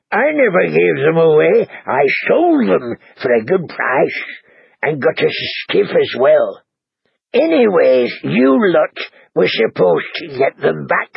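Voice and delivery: lightly voiced grumbling innkeeper; a touch of a defensive old husband; annoyed husband.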